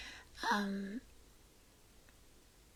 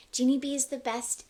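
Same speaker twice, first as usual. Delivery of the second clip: plain; 2 semitones higher, 7 dB louder than usual. Real, not acted. Cheering her guest.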